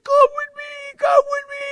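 put-on very high, squealing old man. Older friend dragging him to the front, high excited squeal. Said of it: "Ed's an older gentleman".

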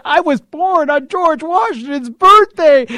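loud, high, slow insistence; insistent protest. Retold or imitated. Husband justifying himself to his doubtful wife, loud high insistence. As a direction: push it out as excited self-justification.

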